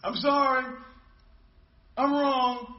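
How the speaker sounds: high, loud, drawn-out admissions; emphatic mock apology. Preacher acts out someone apologizing to God, loud and emphatic. Acts out a half-hearted penitent.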